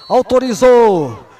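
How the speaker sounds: rising shouted goal call; explosive goal commentary. Real, not acted. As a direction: build up to a yelled goal. Goal scored in live commentary.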